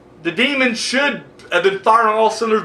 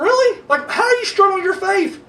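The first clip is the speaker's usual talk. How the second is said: incredulous retort; 13 semitones higher than usual. Retold or imitated. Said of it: "I'm like".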